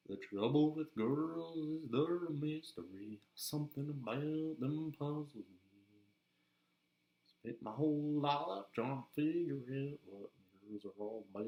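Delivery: slow, sung Southern country drawl; Southern accent. Shown as a demonstration. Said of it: "go into voice really easily".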